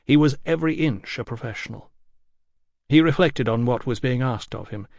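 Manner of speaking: plain reading voice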